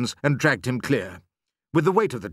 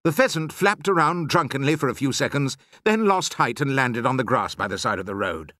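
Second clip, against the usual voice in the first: plain reading voice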